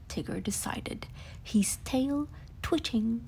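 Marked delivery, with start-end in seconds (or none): none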